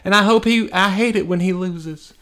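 put-on high, starry-eyed child voice, slow; naive kid fan. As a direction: gush like a kid who believes it all. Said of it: "you're a kid and you believe it".

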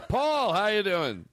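high, drawn-out phone greeting; put-on hearty caller, stretching the words; pretend company man. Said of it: "I have to be the Shittens guy".

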